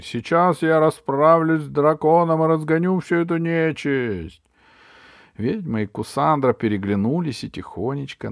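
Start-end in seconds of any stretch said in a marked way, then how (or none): 0.0-4.4 loud, raised heroic boast; shouting. A narrator's reading. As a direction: promise battle at full voice. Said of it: "крикнул богатырь". (громкий боевой клич богатыря)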